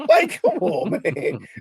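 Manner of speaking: high, laughing exasperation; laughing disbelief. Real, not acted.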